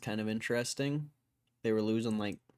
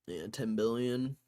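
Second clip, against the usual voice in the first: ordinary talk, nothing marked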